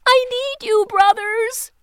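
high, loud breathless plea for help; gasping plea. Acted. Teeny bursting in on her brothers, high gasping plea. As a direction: burst in and beg your brothers. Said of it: "to gasp".

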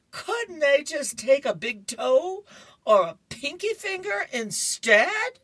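high, loud, hammed-up pleading; hysterical Curtis. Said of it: "he's still crying and he's hysterical".

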